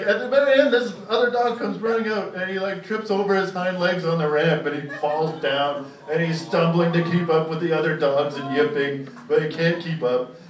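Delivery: ordinary talk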